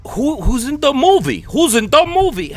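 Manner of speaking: high, loud mock German tourists; hammed-up German accent, pitched high. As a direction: imitate clueless German friends, loudly demanding. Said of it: "So they're like".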